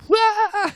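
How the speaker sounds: sudden very high, loud shriek; shocked shriek. Acted. Neighbor lady shrieking when Krishna says he'll become her husband. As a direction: let out a startled scream.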